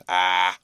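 loud growled ah; growl between teeth. A narrator's reading. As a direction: growl it through clenched teeth. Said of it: "growled the journeyman between his teeth".

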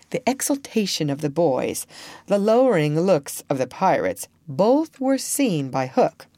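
plain reading voice

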